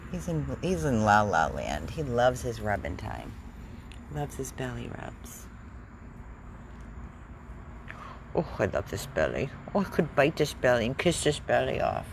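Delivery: soft low cooing to a pet. Real, not acted. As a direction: croon softly at the dog. Cooing to the dog softly.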